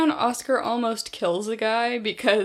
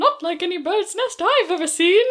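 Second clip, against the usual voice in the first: higher-pitched put-on character voice; character impression; 8 semitones higher, 4 dB louder than usual. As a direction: imitate her, pitched well up. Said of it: "That was a good impression".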